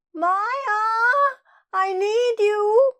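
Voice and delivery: very high, drawn-out holler; calling out. Acted. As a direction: call Maya from far down the street. Neighbour calling Maya once more.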